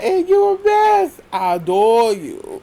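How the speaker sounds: very high, loud mocking. Mocking the fake apology with a very high, loud voice.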